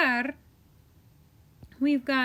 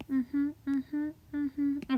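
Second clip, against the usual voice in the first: humming; 3 semitones higher, much slower than usual. Real, not acted. Humming while copying endings.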